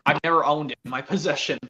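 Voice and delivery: usual speaking voice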